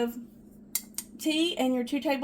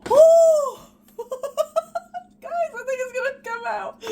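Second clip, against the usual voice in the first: high squealing laugh breaking into words; excited squeal; 13 semitones higher, 10 dB louder than usual. Real, not acted. Cake sliding out, she squeals with excitement. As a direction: shriek with delight as the cake releases. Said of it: "Sorry, I got excited".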